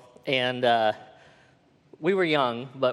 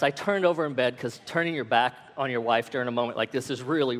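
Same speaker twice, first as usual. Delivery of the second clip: usual speaking voice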